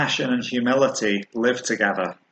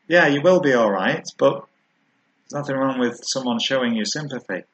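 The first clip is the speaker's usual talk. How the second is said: normal talking voice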